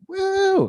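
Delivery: high-pitched cheering whoop; long, high "woo"; crowd "woo". Retold or imitated. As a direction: imitate an audience whooping. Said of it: "they always like".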